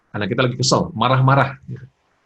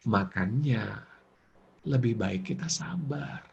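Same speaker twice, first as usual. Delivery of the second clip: bringing it down; 8 dB quieter, slower than usual. Shown as a demonstration. Friend calming an angry friend, lowered soft voice. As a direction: drop straight down into a gentle, soft tone. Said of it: "Nih, turunin langsung".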